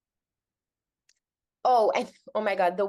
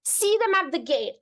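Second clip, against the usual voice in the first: ordinary talk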